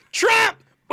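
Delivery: very high, loud whooping squeal; MAGA squeal. Shown as a demonstration. Showing off a MAGA impression, loud high squeal. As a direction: shriek it like a frenzied rally supporter. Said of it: "I can do a maga squeal".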